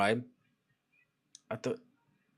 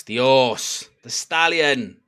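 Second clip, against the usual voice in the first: louder, punchy praise; hyping up; 7 dB louder, much slower than usual. Real, not acted. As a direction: bellow it like a proud fan. Hyping player after a good play.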